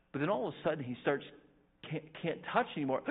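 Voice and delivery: usual speaking voice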